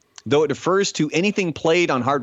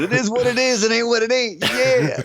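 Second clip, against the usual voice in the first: high, laughing stoner voice; laid-back dude quote; 10 semitones higher than usual. Retold or imitated. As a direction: chuckle through the dude line. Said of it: "our promo for this episode".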